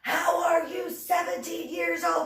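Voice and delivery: high-pitched shouted disbelief; imitated incredulous shout. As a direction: shout it like a flabbergasted officer. Retold commander yelling at recruit.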